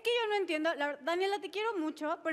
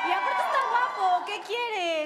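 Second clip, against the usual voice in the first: higher, louder incredulous protest; exasperated outburst; 5 semitones higher, 8 dB louder than usual. Real, not acted. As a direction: push back, almost pleading. Defending the rejected date.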